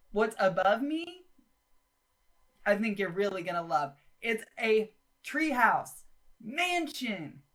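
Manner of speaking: usual speaking voice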